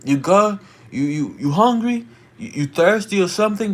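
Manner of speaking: imitated shout, questions pitched high; shouted concern, retold. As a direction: call across to check on a stranger. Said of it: "shouting, I'm like".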